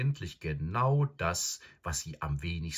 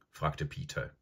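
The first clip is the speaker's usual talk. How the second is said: ordinary delivery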